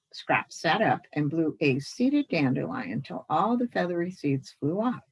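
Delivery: ordinary delivery, nothing marked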